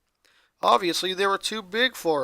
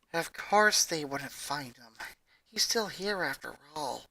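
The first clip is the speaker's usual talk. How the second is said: muttering; 2 semitones lower, 8 dB quieter than usual. Acted. Ronnie Ann muttering to herself in bed. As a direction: mutter it under your breath. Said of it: "she muttered".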